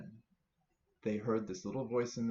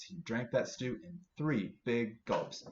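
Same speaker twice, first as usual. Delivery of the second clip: usual speaking voice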